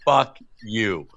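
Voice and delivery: usual speaking voice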